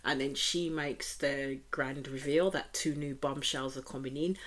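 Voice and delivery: ordinary talk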